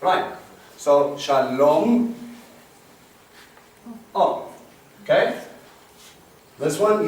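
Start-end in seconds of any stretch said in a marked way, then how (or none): 1.2-2.1 shalom stretched slowly to show the o; teacher stretching shalom; slow demo word. Shown as a demonstration. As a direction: drag out the o in shalom. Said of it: "it's an o sound".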